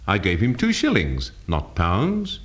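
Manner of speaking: "shillings" carries the main stress. Shown as a demonstration. Teacher showing emphasis changes meaning, stress on "shillings". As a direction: hit "shillings" to contrast it with "pounds". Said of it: "different readings of a simple statement".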